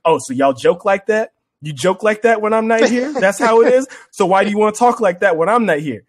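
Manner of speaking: put-on mad girlfriend. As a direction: needle him like a jealous girlfriend. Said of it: "then you gotta sit there and be like".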